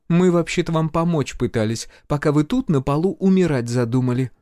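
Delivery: high-pitched female healer reproaching; healer, matter-of-fact reproach. A narrator's reading. Healer tells the major they tried to help, reproachful.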